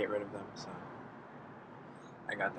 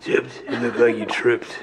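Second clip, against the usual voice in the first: laughing through the words; slower than usual. Real, not acted. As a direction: tease her while laughing. Repeating a teasing remark.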